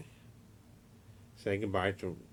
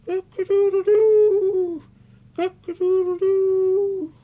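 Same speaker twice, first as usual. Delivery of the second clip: very high, much louder slow crowing; rooster crow; 14 dB louder, slower than usual. Acted. Fred the puppet crows instead of monkey sounds, very high loud slow crow. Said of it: "I'm getting frustrated with you".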